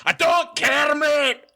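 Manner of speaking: high, hammed-up shouting Scotsman; mock Scottish rant. A Scot scolding an American claiming heritage, mock shouted accent. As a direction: bellow it like an offended local, slow. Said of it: "you're gonna have those people that are like".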